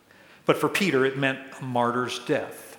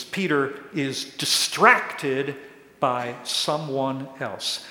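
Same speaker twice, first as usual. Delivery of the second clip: usual speaking voice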